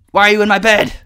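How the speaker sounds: loud, high outraged yell; real startled outrage; shocked shout. Real, not acted. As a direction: yell at the mob in your bed. Mob found in bed.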